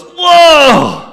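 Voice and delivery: startled by a sudden kick inside; surprised whoa. Retold or imitated. Elizabeth feeling the baby leap, surprised cry. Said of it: "Elizabeth goes".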